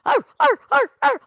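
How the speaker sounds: short, high, loud barks; punchy high-pitched yaps; dog bark. Shown as a demonstration. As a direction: bark like a small dog in quick even bursts. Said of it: "bark, meow".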